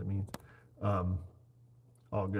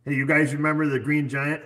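calling out; 6 semitones higher, 9 dB louder than usual. Real, not acted. Calling out to the chat.

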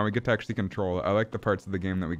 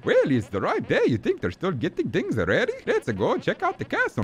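high cartoon Italian brother voice; high-pitched, excited game sidekick; Luigi, eager; 10 semitones higher than usual. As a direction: chatter eagerly as a cartoon plumber.

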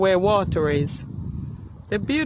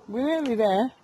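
ordinary talk

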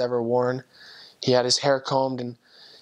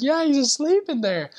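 high-pitched re-enacted insistence; incredulous insistence; 13 semitones higher than usual. Missionary insisting to his doubting companion, incredulous.

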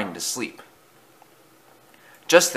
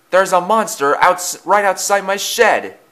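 high-pitched, urgent insistence; alarmed protest; 9 semitones higher, 3 dB louder than usual. A narrator's reading. Thomas warns laughing Gordon, high alarmed protest. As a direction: insist on the danger.

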